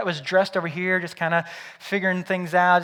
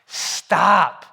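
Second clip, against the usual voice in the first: firm loud call; 8 dB louder, much slower than usual. Retold or imitated. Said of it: "So he's like".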